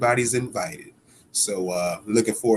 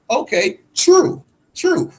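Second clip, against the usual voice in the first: sarcastic concession; 14 semitones higher, 4 dB louder, slower than usual. Real, not acted. Mock concession to rival promoter.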